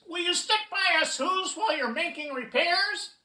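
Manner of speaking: high, loud little voice asking; squeaky mayor begging help; pleading. A narrator's reading. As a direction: implore from the dust speck. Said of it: "pleaded the voice".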